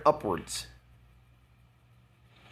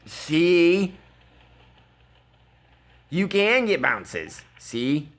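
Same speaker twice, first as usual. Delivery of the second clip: louder, slow taunting "See?"; drawn-out teasing told-you-so; mock gloating; 3 semitones higher, 4 dB louder, much slower than usual. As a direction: rub the bounce in his face. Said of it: "And I'm like".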